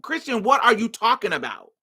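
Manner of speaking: high-pitched, insistent questioning; exasperated disbelief. Retold or imitated. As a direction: re-enact pressing a friend for an answer. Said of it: "this is literally how I'm talking to her".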